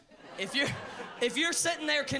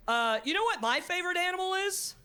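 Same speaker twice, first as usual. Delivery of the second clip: wife's question; 7 semitones higher than usual. Retold or imitated. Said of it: "she said".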